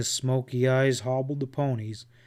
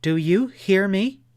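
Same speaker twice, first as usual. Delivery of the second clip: higher and louder, insisting; emphatic demand; 6 semitones higher, 6 dB louder than usual. Acted. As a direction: stamp the words home. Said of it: "She stamped a small foot to emphasize the words".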